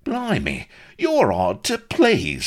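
high, exasperated Cockney retort; snapping. A narrator's reading. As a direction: snap back, fed up. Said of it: "snapped Vladimir".

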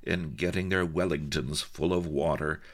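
ordinary delivery, nothing marked

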